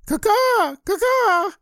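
very high, loud caw-caw; piercing imitation rooster squawk; shouting rooster. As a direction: screech like a rooster. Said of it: "scared that it would shout".

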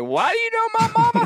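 high, fast shouted alarm; mock frantic yelp; panicked disbelief. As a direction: blurt it out in shock.

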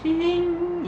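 high whinnying neigh; whinny high like a horse. Shown as a demonstration. Quiz clue for an animal, a high horse whinny. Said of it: "はい、どうぞ".

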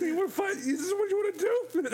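squaring up, taunting; aggressive mock challenge. Acting crack mom picking fight.

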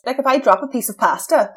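ordinary delivery

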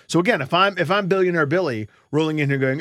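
usual speaking voice

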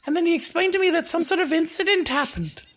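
high-pitched schoolgirl, rattling on; Harriet. Acted. Harriet repeats what Mister Gallagher told her, high-pitched and chatty. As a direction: recount it in a high, girlish voice. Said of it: "Harriet said".

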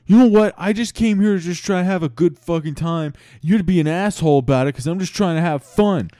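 high, loud indignant yelling; outraged rant. Acted. Chad stumbling out of the beef, yelling indignantly. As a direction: yell in whiny, aggrieved protest.

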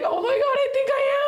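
high, laughing near-cry; hammed-up teary squeal through laughter; mock tearful. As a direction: imitate yourself about to burst into tears. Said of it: "Are you about to cry?".

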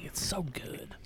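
soft whisper of delight. Real, not acted. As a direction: whisper it, savoring. Savoring beer.

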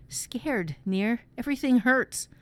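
high, pained frightened voice; mildly acted frightened sufferer; scared and hurting. A narrator's reading. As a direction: plead in pain to a friend. Feverish boy in pain.

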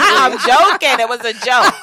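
high, loud, through laughter; real laughing protest. Real, not acted. Host retracting her joke about the husband, laughing loudly. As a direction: crack up while hastily taking it back.

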